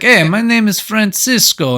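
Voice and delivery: high, hammed-up foreign accent; Francisco. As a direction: put on a silly, inconsistent foreign accent. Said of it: "his accent".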